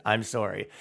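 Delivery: mock sorry. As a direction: murmur it like a sheepish confession. Voicing guilty voter to mom.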